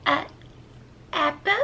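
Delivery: put-on high, soft little-kid voice; hesitate, then read it like a tiny child; baby voice. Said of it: "my baby voice".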